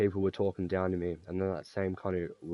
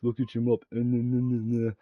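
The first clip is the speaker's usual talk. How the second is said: mocking taunt; 3 semitones higher, 4 dB louder than usual. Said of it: "he's like".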